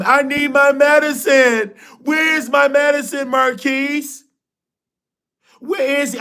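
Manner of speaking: mock patient whining for her meds; needy patient. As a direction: call out for your medicine like a demanding patient.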